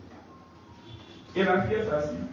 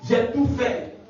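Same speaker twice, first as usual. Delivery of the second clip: much higher accusing spirit; demon accusing; 9 semitones higher than usual. Retold or imitated. Demon in the girl accusing the preacher. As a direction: accuse him in a high demon voice.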